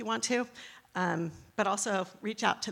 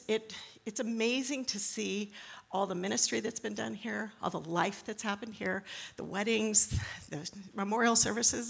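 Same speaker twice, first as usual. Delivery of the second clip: usual speaking voice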